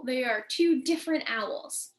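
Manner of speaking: usual speaking voice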